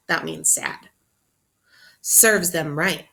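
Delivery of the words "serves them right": smug. Acted. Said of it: "thought Charlie".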